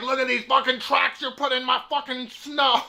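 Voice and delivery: high mock Donald Duck complaint; imitated electrolarynx scolding. Old man yelling about tracks in snow, high mock scolding. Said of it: "He yelled at me for making tracks".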